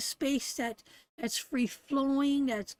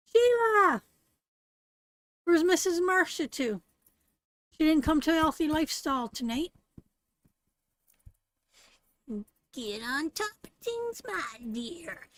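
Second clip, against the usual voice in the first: put-on old witch voice; 4 semitones higher than usual. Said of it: "now I can really talk in my old witch voice".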